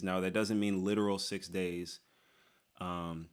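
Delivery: ordinary talk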